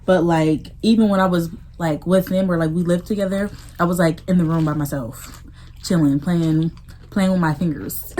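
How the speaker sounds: normal talking voice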